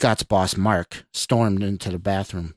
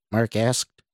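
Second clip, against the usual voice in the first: plain reading voice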